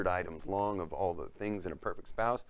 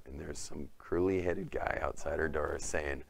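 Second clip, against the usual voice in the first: ordinary talk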